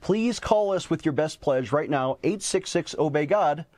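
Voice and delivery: ordinary talk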